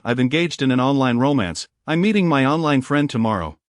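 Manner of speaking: ordinary delivery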